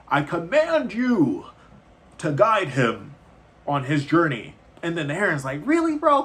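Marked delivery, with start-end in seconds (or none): 0.1-4.6 slow, loud and high booming decree; the Wizard of the Tower orders the heron, slow booming command. Retold or imitated. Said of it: "the Wizard of the Tower is like". 5.6-6.2 squeaky high, loud disbelief; the heron whining at the wizard's command, high exasperated squeak; exasperated protest. Retold or imitated.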